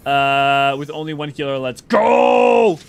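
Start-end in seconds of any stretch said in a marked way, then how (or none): 1.6-2.8 loud, high, drawn-out battle cry; rallying shout. Real, not acted. Streamer cheering the party on with only one healer, in a rallying shout.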